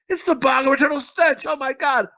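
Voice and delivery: high-pitched mock disgusted outcry; hammed-up high exclamation; retold exclamation. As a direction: cry out high, reacting to a terrible stink. Acted reaction to a stink.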